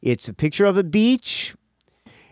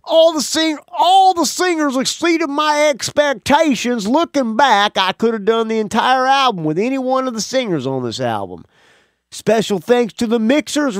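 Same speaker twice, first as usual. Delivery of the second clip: Southern accent; 6 semitones higher than usual. Shown as a demonstration. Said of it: "I'm changing his accent now. Now he's Southern".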